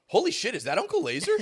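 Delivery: high, loud, laughing mock exclamation; hammed-up umpire recognizing a player; umpire's surprise. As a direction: squeal it like a starstruck umpire. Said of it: "and then goes".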